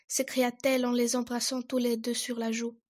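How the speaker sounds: ordinary delivery, nothing marked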